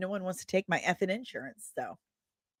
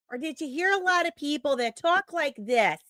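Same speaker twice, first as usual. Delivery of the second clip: put-on higher-pitched caricature of a St. Louis accent; mock local accent; 6 semitones higher than usual.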